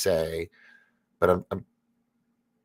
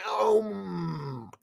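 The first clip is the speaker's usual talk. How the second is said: mock startled oh; 12 semitones higher than usual. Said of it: "I was like".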